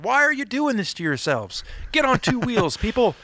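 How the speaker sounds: a pleading, half-joking call to get on bikes; exasperated plea. Retold or imitated. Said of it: "I'm like".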